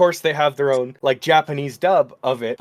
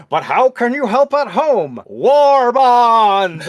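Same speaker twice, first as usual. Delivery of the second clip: put-on old propaganda reel; 7 semitones higher, 5 dB louder, much slower than usual. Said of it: "And it's like".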